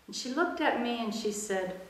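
ordinary delivery

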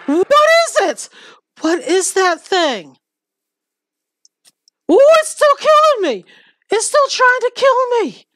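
very high, loud shrieking panic; scared horror game streamer. Real, not acted. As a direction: shriek while fleeing. Chased again by the mob.

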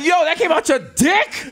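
high, slow disbelieving question; shocked. Retold or imitated. Seven-year-old son seeing the stone, slow shocked high question. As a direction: draw it out, stunned.

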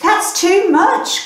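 high, slow alarmed cry; boy cries at the overflowing pot in alarm; cried out. Acted. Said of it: "cried the boy".

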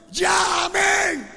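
high, long-drawn shouted amen; drawn-out pulpit cry; insistent amen cry. Retold or imitated. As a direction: belt out the amen like a revival preacher. Acts out insistent prayer cries.

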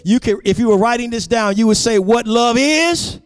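high, insistent teaching sermon; emphatic preaching. Real, not acted. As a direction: build the points with pitch held high. Preacher builds up the list.

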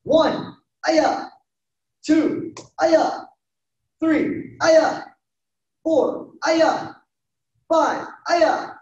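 high, punchy count with short kiai cries; loud yells. Real, not acted. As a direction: call the number, then yell a sharp kiai. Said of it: "Loud yells".

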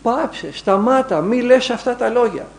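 high, strained demon voice, pleading and angry; demon's howling voice. Retold or imitated. Demon inside the possessed monk protests the prayer, high and strained. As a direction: cry out as the demon tormented by the holy name. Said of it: "Άλλη φωνή, όχι η δική του".

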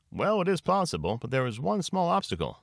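high-pitched lawyer character voice; Mister Troubleson. A narrator's reading. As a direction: voice a lawyer hedging, pitched well up. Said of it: "said Mister Troubleson".

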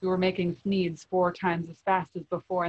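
plain reading voice

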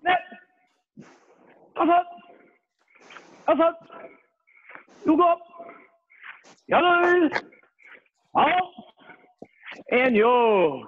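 loud, high, punchy Korean count; shouted drill count. Real, not acted. Instructor pushing the class through fast reps, shouted drill count. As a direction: bark each number to drive the reps.

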